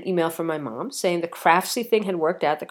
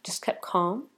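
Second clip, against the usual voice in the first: hushed calm; 8 dB quieter than usual. Retold or imitated. Recalling staying calm on plane.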